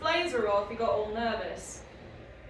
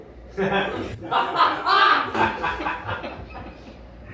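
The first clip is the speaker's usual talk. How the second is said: loud helpless laugh; a big embarrassed laugh breaking out; laughing hard at the memory; burst of laughter; 4 semitones higher, 8 dB louder than usual. Real, not acted. Laughing while recalling sex.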